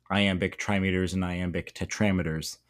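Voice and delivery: ordinary delivery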